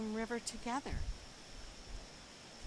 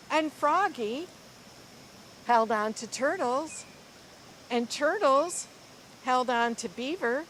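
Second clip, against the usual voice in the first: lively, expressive storybook reading; reading to children; 3 semitones higher, 6 dB louder than usual. Acted. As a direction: read it like a picture book to a class. Teacher reads picture book aloud.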